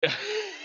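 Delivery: high burst of laughter. Real, not acted.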